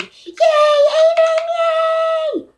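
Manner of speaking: very high, loud squealed cheer; mock the overeager kid greeting her; imitating an excited kid. Classmate squealing her name at her, very high mock cheer.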